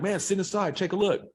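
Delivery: high, coaxing invitation; mock inviting voice. Man with a Ferrari coaxing the kids inside, high voice. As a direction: imitate the man luring them in. Car owner voiced in story.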